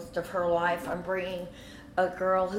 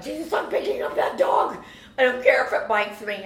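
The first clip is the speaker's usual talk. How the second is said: high, loud, determined outburst; frantic shouting; 5 semitones higher, 6 dB louder than usual. Retold or imitated. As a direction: call it out in a panic. Acting out frantic shouted prayer over dog.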